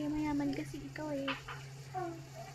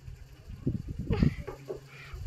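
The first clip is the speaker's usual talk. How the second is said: breathy giggle; 5 semitones higher, 3 dB quieter than usual. Acted. Laughing at the advice.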